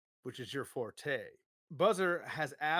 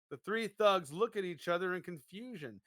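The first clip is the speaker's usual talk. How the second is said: normal talking voice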